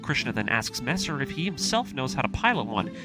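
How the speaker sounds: usual speaking voice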